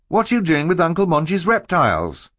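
put-on high, challenging child's voice; young boy's question. A narrator's reading. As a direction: demand an answer as a boy. Boy challenges stranger taking reptiles.

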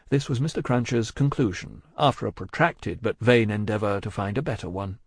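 ordinary delivery, nothing marked

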